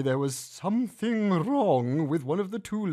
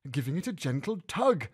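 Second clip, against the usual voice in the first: ordinary delivery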